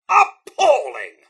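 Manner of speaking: high, loud, drawn-out indignation; indignant outrage. Acted. Butler appalled at what raiders have done, loudly indignant. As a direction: stretch it out in scandalised protest.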